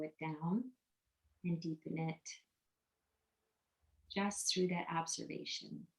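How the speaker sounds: soft, unhurried meditation voice with long pauses; guided meditation. Real, not acted. Meditation guide leading listeners to notice their breath, soft guided meditation. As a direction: quietly guide them through each breath, letting silences sit.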